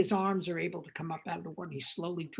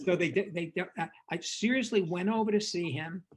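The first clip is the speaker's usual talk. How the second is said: normal talking voice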